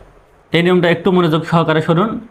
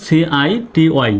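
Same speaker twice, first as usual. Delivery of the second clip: slow spelling; much slower than usual. Shown as a demonstration. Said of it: "একটু লক্ষ্য করুন".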